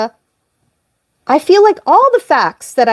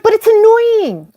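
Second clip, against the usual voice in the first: whiny protest; 10 semitones higher than usual. Retold or imitated. Retold protest to Brian.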